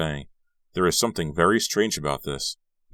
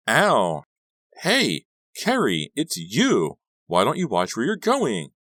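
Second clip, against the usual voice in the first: high, loud cry after a collision; yelp, then scold in a raised pitch; startled outburst; 7 semitones higher, 5 dB louder, slower than usual. A narrator's reading. Margot bumped in the street by Carrie, startled and loud.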